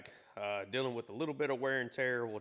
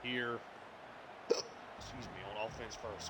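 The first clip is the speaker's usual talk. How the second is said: talking through hiccups; interrupted by a hiccup; 4 dB quieter than usual. Real, not acted. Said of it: "Sorry for the hiccups, guys".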